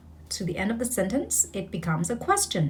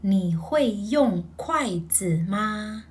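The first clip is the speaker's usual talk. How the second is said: slow, careful full sentence; slow Mandarin practice line; slow repeat; much slower than usual. Shown as a demonstration. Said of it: "One more time".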